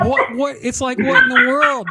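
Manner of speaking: high, loud, incredulous what in the world; mock disbelief. Host mocking toilet paper panic buyers, high incredulous yelp. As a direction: throw up your hands at the hoarders.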